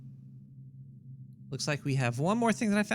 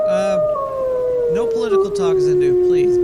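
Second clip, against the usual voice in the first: much higher, loud protest; high-pitched shout; 12 dB louder than usual. Acted. Scolding the alien character.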